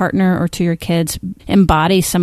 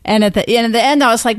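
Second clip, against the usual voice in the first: ordinary talk, nothing marked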